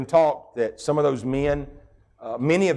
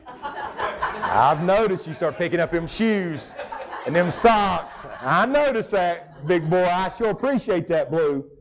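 higher and slow, sugary mock praise with a chuckle; mock sarcastic praise; 5 semitones higher, slower than usual. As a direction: tease the spouse with fake gratitude, drawn out and high. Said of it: "I'm not gonna say".

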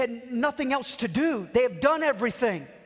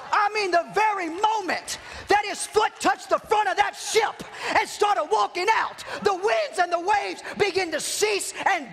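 full-force preaching; 7 semitones higher than usual. Real, not acted. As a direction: preach it up to a fever pitch.

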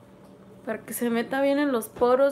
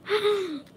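pained laugh; 9 semitones higher than usual. Real, not acted. Peeling off face mask.

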